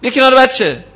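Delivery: high, sharp parental shout, acted out; mock yell. (داد نمایشیِ مادر سر بچه)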